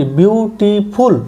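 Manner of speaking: বিউটিফুল slowly split as বিউ-টি-ফুল; teacher breaking "বিউটিফুল" into syllables. Shown as a demonstration. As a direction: sound out বিউটিফুল in three separate beats. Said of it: "আমরা যখন উচ্চারণ করি".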